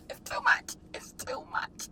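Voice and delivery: soft whispered sob; tearful whisper. Real, not acted. Vlogger overwhelmed in the car, whispering through tears. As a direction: breathe it out, defeated. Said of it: "crying in car".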